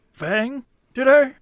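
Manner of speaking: slow "Fang today" with a questioning rise; question inflection. Acted. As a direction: lift "today" high as if asking. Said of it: "the question inflection on that".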